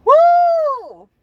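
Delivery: shrieking woo of laughter; high loud whoop. Real, not acted. After retelling the boss, a high loud whooping laugh. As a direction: let out a high screaming laugh.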